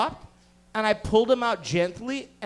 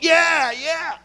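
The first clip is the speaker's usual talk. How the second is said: loud, higher wrestling-fan yell; hyped-up cheering; 7 semitones higher, 7 dB louder than usual. Retold or imitated. Housemates watching wrestling smashes, hyped loud cheer.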